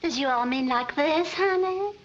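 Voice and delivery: put-on southern accent. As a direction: lay on the Southern charm. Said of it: "don't forget to use your southern accent".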